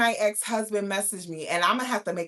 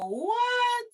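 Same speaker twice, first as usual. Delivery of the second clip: much higher, disbelieving "what?"; incredulous what; 4 dB louder than usual. Retold or imitated. Reacting to the ex saying he will always love her, high and incredulous. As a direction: fire back in disbelief. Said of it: "So I'm like".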